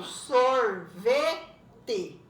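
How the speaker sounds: "sorvete" slow and loud, sor - ve - te; split into syllables. Shown as a demonstration. Teacher showing it is separated, "sorvete" as sor - ve - te. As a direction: pull "sorvete" apart into three beats. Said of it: "ele tá separado".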